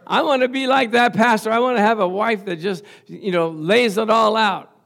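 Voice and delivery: comic begging, pitched high; crying out to God. Retold or imitated. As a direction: keep up the hammed-up pleading prayer. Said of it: "I cried out".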